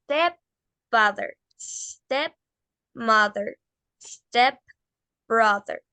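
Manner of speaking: Stepfather, stepmother, stepbrother very slowly, each opening on a long hiss "sss-tep"; S inicial larga. Shown as a demonstration. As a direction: hold the S before step, then pause before the second half. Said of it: "hagámosla larga". (stepfather, stepmother, stepbrother con la S muy alargada, "ssstep")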